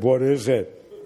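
lightly acted sleepy voice, slow; groggy mumble. Neighbor woken in the night, lightly groggy mumble. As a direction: drag it out as if just woken. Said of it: "a groggy voice came and said".

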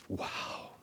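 hushed wow, barely voiced; awed whisper. Real, not acted. Pastor picturing the bride's entrance, awed whisper. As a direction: breathe the word out in a whisper.

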